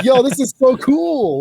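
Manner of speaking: high, loud, drawn-out hype; excited reaction. Retold or imitated. Gamer gushing over new animation, excited. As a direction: gush with hyped-up awe.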